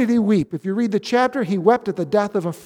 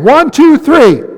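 louder retry count; 4 semitones higher, 12 dB louder, slower than usual. Shown as a demonstration. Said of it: "Try it again".

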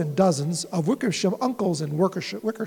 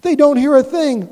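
calling back; 4 semitones higher, 7 dB louder than usual. A narrator's reading. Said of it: "Horton called back".

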